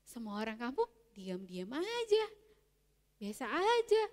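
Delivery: usual speaking voice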